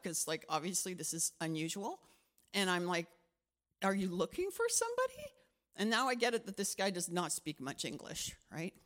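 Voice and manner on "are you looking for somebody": asking a stranger. Retold or imitated.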